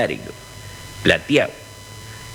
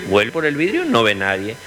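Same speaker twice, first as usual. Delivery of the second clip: ordinary talk, nothing marked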